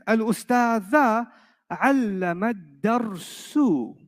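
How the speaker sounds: slow Arabic with the case endings clear; Arabic sentence read slowly. Shown as a demonstration. Teacher reading the example sentence slowly to students. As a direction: read "Al-ustadha allama ad-darsu." slowly, marking each ending. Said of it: "second sentence".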